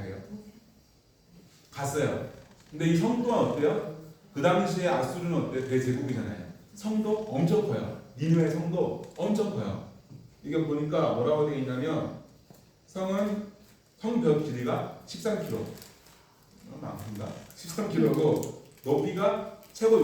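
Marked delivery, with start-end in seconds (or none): none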